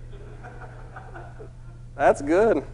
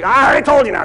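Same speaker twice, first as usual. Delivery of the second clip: higher, louder, losing patience; impatient raised voice repeating himself; getting upset; 10 semitones higher, 11 dB louder, faster than usual. Retold or imitated. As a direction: play the owner's rising irritation. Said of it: "He's getting a little upset".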